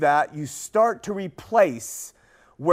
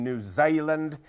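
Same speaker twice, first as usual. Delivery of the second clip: ordinary delivery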